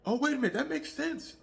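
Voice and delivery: realizing. Retold or imitated. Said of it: "And I'm going like".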